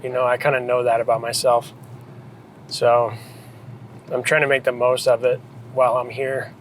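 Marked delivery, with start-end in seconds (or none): none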